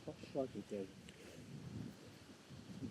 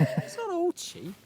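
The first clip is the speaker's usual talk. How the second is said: mock indignant; 4 dB louder, much slower than usual. Real, not acted. Defending gear, mock indignant.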